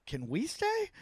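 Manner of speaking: high, soft retold plea; meek request. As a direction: mock-meekly ask permission in a small, high voice. Asking permission of officials, retold.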